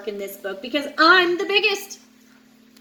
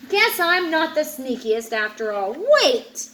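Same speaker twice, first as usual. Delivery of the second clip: ordinary delivery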